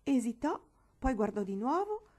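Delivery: ordinary delivery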